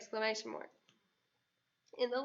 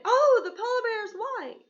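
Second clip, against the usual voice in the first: much higher, louder excited cry; 9 semitones higher, 11 dB louder than usual. Shown as a demonstration. Teacher contrasting an exclamation for the class, excited. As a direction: exclaim it as if amazed. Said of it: "I'm not getting excited".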